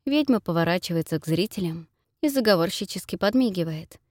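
plain reading voice

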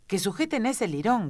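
high-pitched, restrained cry of command; queen's cry. A narrator's reading. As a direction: cry out an order as the queen, without full volume. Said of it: "gritó la reina".

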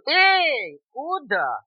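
high, loud, drawn-out bellow; bellowing bear stopping someone; bear's roar. A narrator's reading. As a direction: roar out a challenge. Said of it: "проревел медведь".

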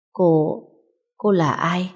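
lightly voiced hoarse, weak injured man; a hint of a hoarse, sickly croak. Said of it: "Chất giọng khản đặc ốm yếu". (giọng khản đặc ốm yếu, nhẹ)

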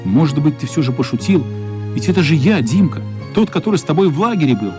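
ordinary delivery

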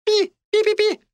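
very high squeaking rat; rat squeaks. A narrator's reading.

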